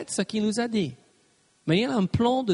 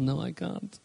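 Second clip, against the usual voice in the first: a hint of a small defeated voice, higher; mock self-pity; 4 semitones higher than usual. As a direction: whine lightly that you're too weak. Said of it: "vous aurez envie ... de dire".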